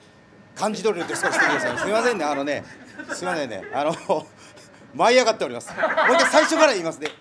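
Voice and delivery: 笑いながら言い直す; 緊張して笑ってしまう; 照れ笑い. Real, not acted. (舞い上がって笑いながら話す)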